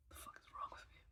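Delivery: soft whisper to himself; hushed self-directed whisper. Real, not acted. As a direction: whisper it under your breath, dismayed. Panicking aside to himself.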